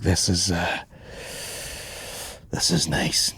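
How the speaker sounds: soft, low, almost whispered appreciation; soft low murmur standing in the nice weather; contented murmur. Retold or imitated. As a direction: breathe it out softly, taking in the view. Quiet contented sigh.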